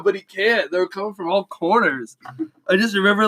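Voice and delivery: usual speaking voice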